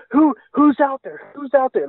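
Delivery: high, urgent mock panic of a scared mother; frightened demand. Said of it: "she's serious now".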